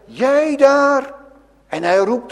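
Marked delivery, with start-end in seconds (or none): 0.1-1.1 higher, called out across the church; Jesus calls the woman at the back; Jesus calling out. Acted. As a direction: call her from the front. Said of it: "De Heer Jezus zegt".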